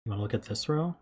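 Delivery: ordinary delivery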